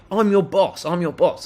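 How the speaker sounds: a mild imitation of the irate customer; imitating an angry boast. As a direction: throw his bossy claim back in a mock shout. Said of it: "starts saying to me".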